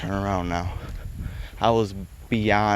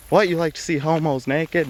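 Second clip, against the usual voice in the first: higher, incredulous echo of the line; Joe Dirt reply; 8 semitones higher than usual. Retold or imitated. Quoting the Joe Dirt comeback, higher mock disbelief. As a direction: bounce it back in mock disbelief. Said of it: "You know, like, from Joe Dirt".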